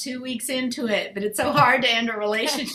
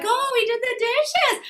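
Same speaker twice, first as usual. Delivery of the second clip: much higher sing-song fake praise; mom praising dad; 12 semitones higher than usual. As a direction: gush over the dishes, covering for him. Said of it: "she'll go like".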